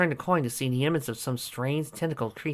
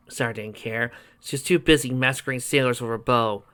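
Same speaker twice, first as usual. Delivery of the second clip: plain reading voice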